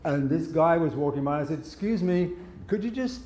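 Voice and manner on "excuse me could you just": high, mock-timid asking voice; polite plea. Tied-up man asking a passer-by for help, high polite voice. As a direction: ask meekly, voice climbing high.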